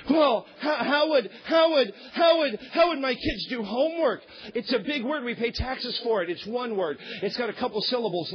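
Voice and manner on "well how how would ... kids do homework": high, louder, stammering mock panic; mock flustered churchgoer. Pastor mimicking a parent worried about homework, high flustered stammer. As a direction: sputter the excuse like a caught-out parent.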